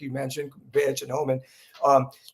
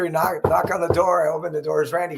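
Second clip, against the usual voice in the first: ordinary delivery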